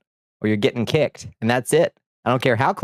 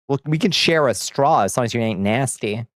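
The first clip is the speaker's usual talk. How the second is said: normal talking voice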